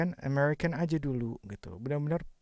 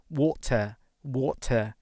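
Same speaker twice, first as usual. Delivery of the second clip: water said wota, no r at the end; British water with a clear t; British accent; much slower than usual. Shown as a demonstration. Teacher contrasts for students, British accent.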